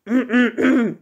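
loud, self-important throat clearing. A narrator's reading. Bear prepares to read his poem, loud throat clearing. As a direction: clear your throat before reciting. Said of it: "Bear cleared his throat".

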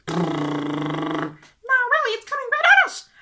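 put-on very high, loud kid warning; a kid insisting the cow is coming; panicked child. Kid voice again.

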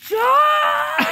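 high, drawn-out holler; calling out. Pretend. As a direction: holler the name high and long. Calling out during playful chase.

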